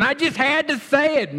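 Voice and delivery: high-pitched caricature of an excuse-maker; mock excuse. Congregant excusing hurtful words, high mock protest. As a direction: protest innocence in a high, put-on voice.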